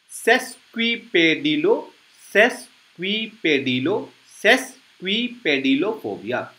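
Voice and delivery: सेस्क्विपेडिलो said slowly and softly, ses-kwi-pe-di-lo, then सेस्क्विपेडिलोफोबिया; careful teacher drill of सेस्क्विपेडिलोफोबिया; slow pronunciation demo. Shown as a demonstration. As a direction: build सेस्क्विपेडिलोफोबिया up in pieces for students to copy. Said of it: "मेरे साथ आप pronounce करने की कोशिश कीजिए".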